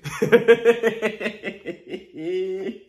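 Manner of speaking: long, drawn-out laugh; long cackle. Real, not acted. YouTuber laughing at his cousin-of-Jesus joke, long cackle. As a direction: crack up at your own joke.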